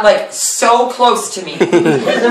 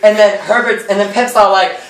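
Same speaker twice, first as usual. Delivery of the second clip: normal talking voice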